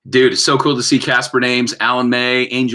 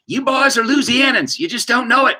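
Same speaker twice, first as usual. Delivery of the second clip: higher mock Southern lady; a hint of a Louisiana lady; imitating Polly; 10 semitones higher than usual. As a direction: lightly voice her teasing line. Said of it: "Polly told us, retold".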